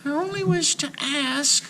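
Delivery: whispered. A narrator's reading. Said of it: "whispered Marusha".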